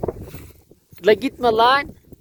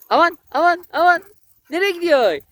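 startled alarm; 8 semitones higher than usual. Real, not acted. Owner startled as her dog runs off, high alarmed cries. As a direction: cry out as the dog bolts.

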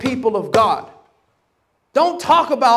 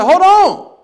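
much higher, much louder panicked cry; panicked shout; 10 semitones higher, 9 dB louder than usual. Retold or imitated. Retelling to the congregation, a panicked shout. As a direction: yell it as the car spins out. Spinning on icy freeway.

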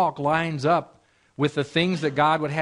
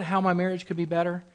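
usual speaking voice

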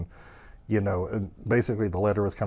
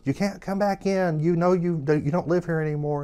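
raised, higher voice, calling through a door; yelling through door; 9 semitones higher, 5 dB louder than usual. Retold or imitated. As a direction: call it out firmly to someone outside. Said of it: "he'd yell through the door".

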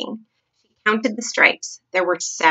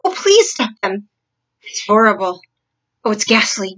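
loud, anguished moaning plea; sleep talking; 5 dB louder than usual. Acted. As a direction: moan and beg in a nightmare. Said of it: "a slightly blurred sleep talker's voice".

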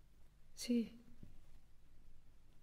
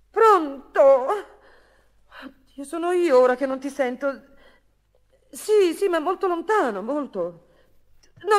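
high, loud, urgent calling down a bad line; 8 semitones higher, 9 dB louder than usual. Losing the line to her lover, frantic loud calling. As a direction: shout into the phone so he hears.

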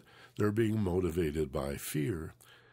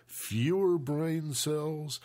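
FEWER BRAIN CELLS repeated higher and drawn out; slow, raised-pitch "fewer brain cells"; voice climbing higher; 4 semitones higher, much slower than usual. Shown as a demonstration. Mocking someone getting upset, voice climbing higher. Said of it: "their voice goes higher".

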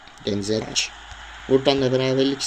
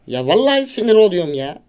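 ordinary talk

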